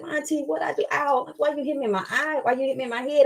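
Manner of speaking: a small voice asking why she was hit; hurt little girl. Retold or imitated. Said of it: "And she's like".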